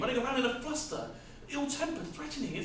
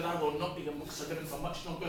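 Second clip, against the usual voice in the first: softer, lower, hurried despair; grim and quiet; 7 semitones lower, 5 dB quieter, faster than usual. Acted. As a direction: drop to a dark mutter. Grim quiet turn after shouting.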